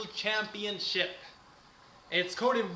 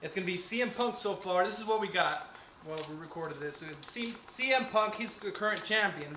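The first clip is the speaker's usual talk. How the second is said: ordinary delivery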